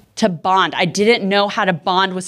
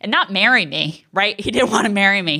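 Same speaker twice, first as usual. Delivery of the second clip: ordinary talk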